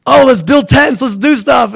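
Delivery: high, eager babbling suggestion; mock over-keen enthusiasm; eager Peter. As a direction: blurt it out with comic eagerness. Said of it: "Peter's so funny. Like, he's like".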